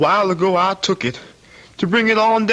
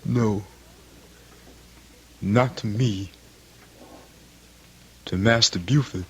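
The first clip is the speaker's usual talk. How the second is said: low and soft, deadly quiet; low grim resolve; 8 semitones lower, 4 dB quieter than usual. Acted. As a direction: say it low like a promise to kill. Son vows to kill master.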